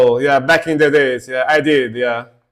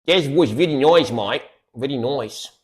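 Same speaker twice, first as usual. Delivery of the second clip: light mock Australian accent, nice as noice. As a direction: put on a casual Australian accent, mate. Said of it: "That was a more like Australian accent".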